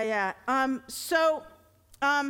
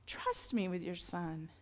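soft echo; 7 semitones lower, 10 dB quieter than usual. Retold or imitated. God's voice, softer echo.

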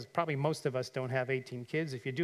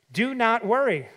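emphatic command; 6 semitones higher, 7 dB louder than usual. Acted. Preacher booming Jesus' command at the congregation. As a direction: Do not worry, loud and high. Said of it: "Look at verse thirty-one".

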